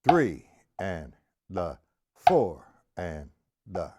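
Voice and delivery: Three and four hit harder, slow count; accent on the first note. Shown as a demonstration. Teacher counting triplets with accent on the first note. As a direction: lean on Three and four, let "and the" fall away. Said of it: "The first note of the triplet".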